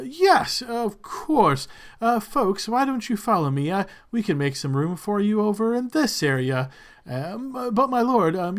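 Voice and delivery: a flustered attendant hurrying to please his lord; nervous attendant. Acted.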